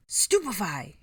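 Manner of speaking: urgently shouted spell; loud battle cry; sudden shout. A narrator's reading. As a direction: cast it with a sudden yell. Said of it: "Harry shouted suddenly".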